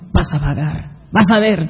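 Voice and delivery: low, soft warning from within; low conscience voice. Acted. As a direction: murmur it like a guilty conscience nagging.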